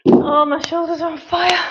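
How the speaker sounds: loud, high burst after exertion; real post-set outburst; pained exclamation. Real, not acted. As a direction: cry out from the muscle burn. Shoulders burning after the set.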